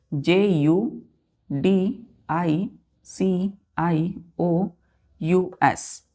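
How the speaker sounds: each letter spaced out slowly for dictation; teacher spelling a word slowly so children write it down. Shown as a demonstration. Teacher dictating letters to children, slow and spaced. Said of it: "teacher spells word letter by letter".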